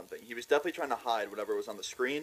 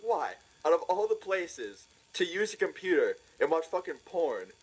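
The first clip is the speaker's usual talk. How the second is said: incredulous, higher and drawn out; retold disbelief; 6 semitones higher, slower than usual. Storyteller asking why the kid did it there, incredulous. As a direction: stretch it out in baffled exasperation.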